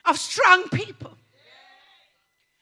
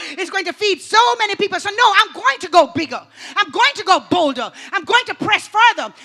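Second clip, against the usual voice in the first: bold faith declaration; 6 dB louder than usual. Pretend. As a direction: declare it like you are holding nothing back. Faith speaking boldly, going all in.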